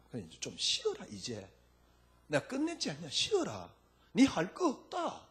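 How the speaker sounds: tender Jesus. Acted. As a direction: soothe them like a loving husband. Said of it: "예수님이라 그러면 여러분에게 뭐라 그러겠습니까?".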